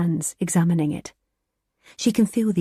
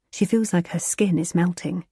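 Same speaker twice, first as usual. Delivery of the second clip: plain reading voice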